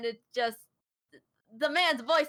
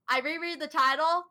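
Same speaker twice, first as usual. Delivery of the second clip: ordinary talk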